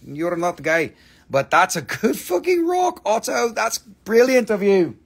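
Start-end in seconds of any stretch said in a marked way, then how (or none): 1.3-5.0 excited. Real, not acted. Excited send-off to buyer.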